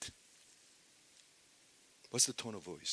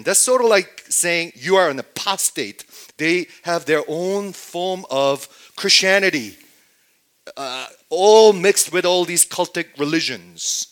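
ordinary talk, nothing marked

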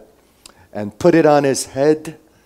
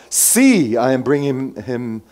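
raised, projected proclamation; addressing a crowd; 2 semitones higher, 5 dB louder, slower than usual. Acted. Pilate presenting Jesus to the crowd, loud proclaiming. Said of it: "and said to the crowd".

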